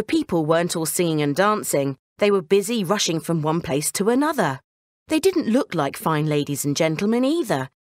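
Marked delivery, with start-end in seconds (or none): none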